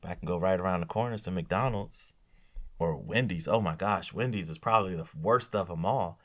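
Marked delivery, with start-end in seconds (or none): none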